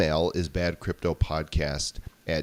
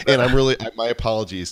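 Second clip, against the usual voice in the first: loud laughing apology; 5 semitones higher, 7 dB louder, much faster than usual. Real, not acted.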